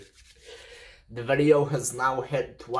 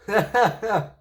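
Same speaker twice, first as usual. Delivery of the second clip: high laugh; 9 semitones higher, 5 dB louder than usual. Real, not acted. Laughing after hitting enemy.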